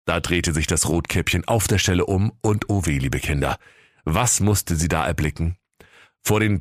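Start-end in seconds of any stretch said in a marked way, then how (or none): 3.0-3.6 storyteller aside. A narrator's reading. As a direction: drop low to address the kids.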